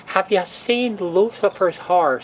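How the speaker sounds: usual speaking voice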